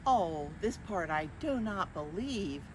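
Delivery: ordinary delivery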